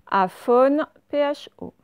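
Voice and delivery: ordinary delivery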